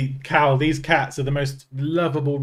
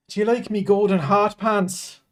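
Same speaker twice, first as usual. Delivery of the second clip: higher, playful Irish lilt; jokey Irish voice; Irish accent; 6 semitones higher than usual. Acted. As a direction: put on a comic Irish accent. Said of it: "is singing in an Irish accent".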